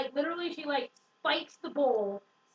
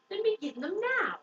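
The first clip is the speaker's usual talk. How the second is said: higher-pitched little pleading voice; lightly voiced dog; 6 semitones higher than usual. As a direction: lightly imitate a small dog insisting. Dog pleads in retold dialogue.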